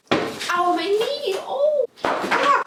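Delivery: high yelps of pain; tripping and falling. Pretend. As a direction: cry out from a hurt knee. Tripping in kitchen segment.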